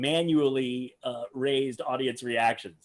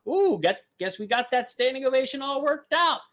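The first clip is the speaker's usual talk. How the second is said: high teasing mock voice; imitating Mac; 11 semitones higher than usual. Commentator mimics the magician shrugging off the ovation, high mock voice. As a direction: play the magician smugly brushing off the ovation, pitched well up. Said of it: "He's like".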